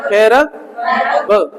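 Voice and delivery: slow "फेराबल", split after a drawn-out "फेरा"; syllable by syllable. Shown as a demonstration. Teacher drills students on the word, slowly splitting "फेराबल". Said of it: "परबल नहीं, फेरा".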